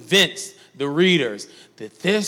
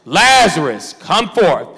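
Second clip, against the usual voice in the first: emphatic preacher's call, moderately raised; loud cry; 4 dB louder, slower than usual. Acted. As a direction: call it out as a command. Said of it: "he cried with a loud voice".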